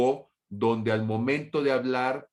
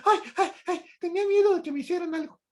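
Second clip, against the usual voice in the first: much higher, fast, hammed-up frightened whine; mock scared excuse; much faster than usual. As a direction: play a timid rabbit confessing his fear. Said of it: "Y entonces digo". (conejito asustado que confiesa su miedo, agudo y rápido)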